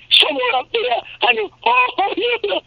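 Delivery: wacky, very high cartoon villain; Quacker Jack. Shown as a demonstration. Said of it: "God, he was so wacky".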